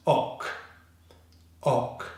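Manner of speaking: "ok" as a short back O closed by a hard K; short O, strong C. Shown as a demonstration. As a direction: say "ok" like the start of October, one syllable. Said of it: "strong C consonant".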